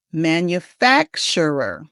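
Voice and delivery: "Manufacturer" slowly, punching FAC in the middle; slow repeat-after-me "Manufacturer", stressed FAC. Shown as a demonstration. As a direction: hit the middle of "Manufacturer": man-yoo-FAK-shur-er. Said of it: "put the emphasis in the middle on fac".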